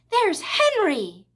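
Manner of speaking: high, long-drawn excited call; crying out. A narrator's reading. Fern spotting Henry at the fair, crying out excitedly. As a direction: sing out the sighting. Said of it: "cried Fern, pointing".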